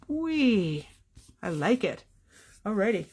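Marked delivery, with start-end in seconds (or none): 0.1-0.9 playful whee. Real, not acted. Playful exclamation at a result.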